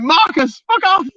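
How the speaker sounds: high, loud mock outburst; comic high-pitched shout of protest; retold exasperated shout. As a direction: yell it as the fed-up victim in a story. Retold outburst at creepy uncle.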